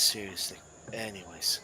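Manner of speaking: ordinary talk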